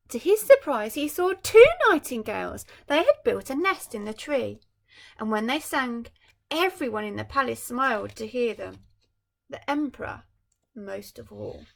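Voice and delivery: bright storybook reading voice; story narration. A narrator's reading. Parent reading a picture book to a child, storytelling. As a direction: read it like a bedtime picture book.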